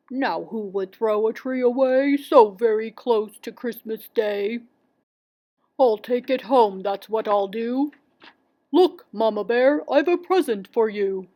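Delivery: storybook bear voice; Barnaby Bear, hearty. A narrator's reading. Bear finds a tree for his wife, heartily. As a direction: play a hearty papa bear.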